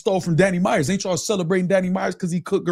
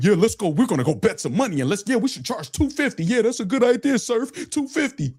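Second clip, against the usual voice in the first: higher, fawning sing-song agreement; mock eager rapper; 5 semitones higher than usual. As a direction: ham up a yes-man to the promoter. Mocking rappers agreeing with Surf.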